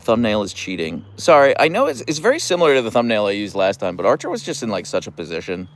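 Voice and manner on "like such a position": ordinary talk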